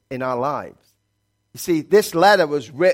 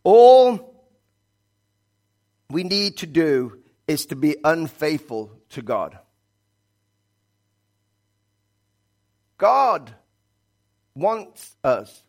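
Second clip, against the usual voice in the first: fiery preaching; 5 semitones higher, 4 dB louder than usual. Acted. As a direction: preach it to the whole church, stretching key words. Preaching at full force.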